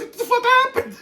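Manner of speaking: very high, laughing squeal; hysterical retold laughter. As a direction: squeak out the quote through laughter. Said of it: "You're hysterical".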